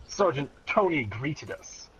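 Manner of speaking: ordinary talk, nothing marked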